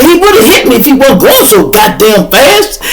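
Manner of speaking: high, loud laughing wail; half laugh, half cry; laughing near tears. Retold or imitated. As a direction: friend laughing almost crying.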